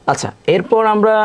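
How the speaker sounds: usual speaking voice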